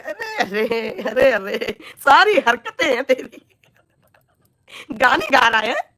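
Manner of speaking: slow, drawn-out mockery; mocking drawl. Ridiculing the troll who sang songs, slow mock drawl. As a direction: stretch it out to ridicule him.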